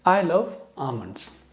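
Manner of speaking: very slow I love almonds, L silent; slow teacher demo; slow model sentence. Shown as a demonstration. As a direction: spell it out in speech for students. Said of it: "इसको समझें".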